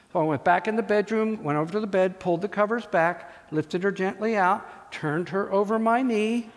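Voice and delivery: ordinary talk, nothing marked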